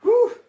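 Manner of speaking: high, loud whew of effort; sharp exhale. Real, not acted. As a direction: blow out a big tired breath. Worn out after hard work.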